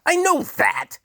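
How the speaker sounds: very high, loud scream. A narrator's reading. The Duke screams at Hark, very high and loud. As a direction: scream it in fury. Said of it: "screamed the Duke".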